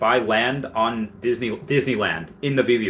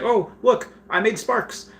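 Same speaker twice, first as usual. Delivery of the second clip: put-on high, bright-eyed little girl voice, delighted; a kid proudly showing off her first sparks; kid showing off magic; 12 semitones higher, slower than usual. Young hero mimicked, showing off her sparks, high and delighted.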